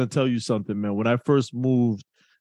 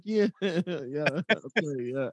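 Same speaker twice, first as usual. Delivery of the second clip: mock nodding along through a laugh; laughing fake agreement; 4 semitones higher, 5 dB quieter, slower than usual. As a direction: play along while laughing. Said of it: "I was like".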